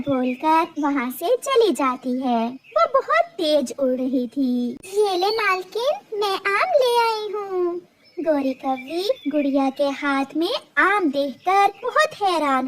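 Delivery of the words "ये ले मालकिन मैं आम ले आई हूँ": high, pleased bird voice; proud little bird. Acted. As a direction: present the mango proudly.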